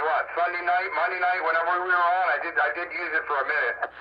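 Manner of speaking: usual speaking voice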